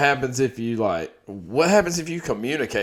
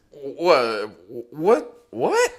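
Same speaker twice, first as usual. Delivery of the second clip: rising, much higher each time; shocked disbelief; 3 semitones higher, much slower than usual. Real, not acted. Reacting to a friend's bizarre question, rising in shock. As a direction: squeak out the disbelief.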